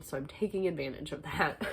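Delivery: normal talking voice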